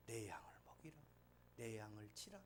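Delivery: soft, near-whispered gentle command; quiet, almost whispered charge to tend sheep; gentle hushed words. Retold or imitated. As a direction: hush it, tender and low-key. Jesus speaking gently to Peter.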